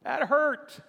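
mock child yelling that he got bitten; little boy crying out. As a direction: squeal it like a bitten four-year-old tattling. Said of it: "and Timmy's out there, and".

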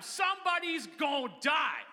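slow, loud, high shout; full-chested dramatic shout; shouted with your chest. Shown as a demonstration. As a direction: belt it with full force. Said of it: "One more time".

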